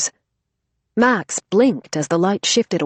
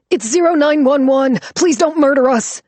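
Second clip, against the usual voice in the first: high, loud terrified scream; 9 semitones higher, 6 dB louder than usual. Acted. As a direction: beg for your life. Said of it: "Riley screamed".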